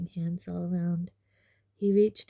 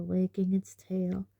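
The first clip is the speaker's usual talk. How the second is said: ordinary delivery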